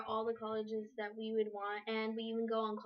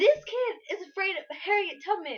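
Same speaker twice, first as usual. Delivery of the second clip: excited; 9 semitones higher, 9 dB louder than usual. Real, not acted. Excited outburst telling a story.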